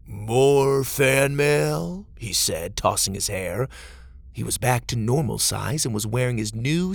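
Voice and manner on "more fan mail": very drawn-out, smug star voice; vain star. A narrator's reading. Vain star arriving to his fan mail, drawn-out and smug. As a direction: stretch it out, preening like a star.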